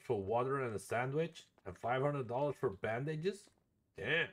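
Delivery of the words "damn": ordinary delivery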